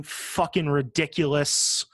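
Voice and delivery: usual speaking voice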